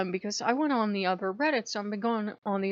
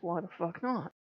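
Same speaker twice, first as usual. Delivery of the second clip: much softer and lower, offhand; deadpan shrug; 4 semitones lower, 8 dB quieter than usual. Retold or imitated. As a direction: toss it off flatly. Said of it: "I'm like".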